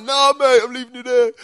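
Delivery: high, loud jeering mate; teasing refusal. Retold or imitated. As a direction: call back gleefully from a distance. Keith refusing to help.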